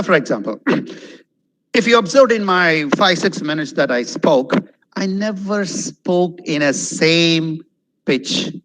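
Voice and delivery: ordinary talk, nothing marked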